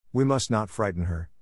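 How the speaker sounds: ordinary delivery, nothing marked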